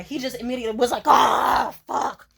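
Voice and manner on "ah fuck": mock rage. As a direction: imitate him exploding in annoyance. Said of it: "threw himself into his pillows in rage".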